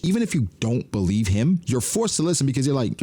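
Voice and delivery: ordinary talk, nothing marked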